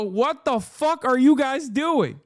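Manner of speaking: imitating an outraged Zelensky; exasperated outburst. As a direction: spell out the disbelief slowly. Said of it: "I look at this and I go".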